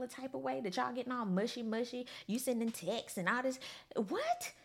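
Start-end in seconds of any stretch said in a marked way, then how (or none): none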